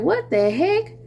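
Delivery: high, surprised what the heck; playful mock shock, pitched high; mock surprise. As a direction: act startled and taken aback. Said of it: "You're gonna be like".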